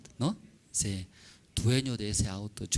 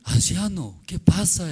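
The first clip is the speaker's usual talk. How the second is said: surprised; 8 semitones higher, 8 dB louder than usual. Acted. Young man astonished.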